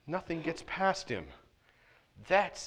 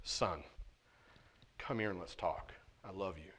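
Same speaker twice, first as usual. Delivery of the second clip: soft, low, tender father voice; a loving dad, quiet and low; gentle good father; 7 semitones lower, 13 dB quieter than usual. Pretend. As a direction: call the son over gently. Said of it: "A good father says".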